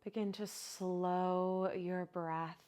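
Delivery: unhurried, even-toned guide into relaxation; guided meditation. Acted. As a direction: ease them into stillness, drawing out the phrase endings. Leads breathing and body relaxation.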